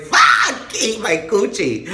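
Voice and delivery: very high, loud shriek; mock panicked outburst. Reacting to a federal government thing, mock panicked shriek. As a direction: explode into a comic high-pitched yell. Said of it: "And I was like".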